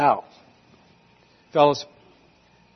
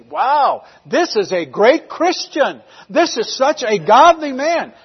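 raised, admiring quote; impressed churchgoer; 6 semitones higher, 4 dB louder, slower than usual. Retold or imitated. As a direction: exclaim it in admiration, voice lifting.

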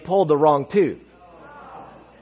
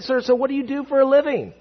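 polite high; 10 semitones higher than usual. Retold or imitated. Polite question at supper.

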